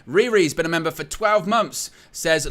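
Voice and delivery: ordinary delivery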